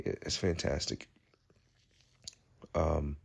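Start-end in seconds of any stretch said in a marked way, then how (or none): 0.0-1.1 soft whisper. Real, not acted. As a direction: whisper it like a secret. Confiding aside about film.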